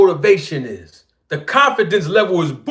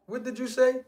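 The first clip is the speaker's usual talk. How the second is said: softer, higher "What did you say?"; a hint of Denzel's menace; lightly voiced Denzel line; imitating Denzel; 6 semitones higher, 8 dB quieter than usual. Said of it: "And Denzel Washington said".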